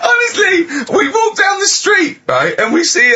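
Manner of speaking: shrieking with real laughter, words squeezed out high and loud; helpless laughter. Real, not acted. Cracking up at Carl's theory on the radio, shrieking with laughter.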